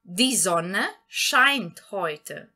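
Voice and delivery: German read very slowly and clearly; slow model sentence. Shown as a demonstration. Teacher shows the swapped word order, very slowly. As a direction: spell it out slowly for learners. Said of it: "Então, a frase poderia ficar".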